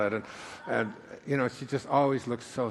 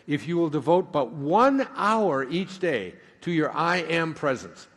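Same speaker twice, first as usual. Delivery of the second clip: solemn reading; 3 semitones higher than usual. Shown as a demonstration. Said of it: "Let me read it to you slow".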